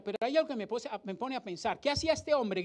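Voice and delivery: ordinary talk, nothing marked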